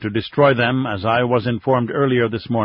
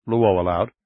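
ordinary delivery, nothing marked

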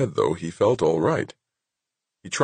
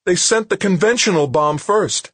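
very high, loud, elated announcement; triumphant high-pitched call; exultant; 5 dB louder than usual. A narrator's reading. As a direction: crow it out, pitched way up. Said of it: "Ginny Anson's voice sounded exultant".

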